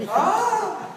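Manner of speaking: high, loud mock scream; short hammed-up tantrum scream; imitated child's scream. Child at the window as father leaves, mock scream. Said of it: "continues to scream".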